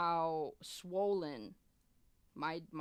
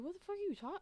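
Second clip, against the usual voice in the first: high-pitched retold shock; bewildered; 13 semitones higher, much faster than usual. Patient waking to a yelling surgeon, bewildered. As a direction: squeal it in startled disbelief.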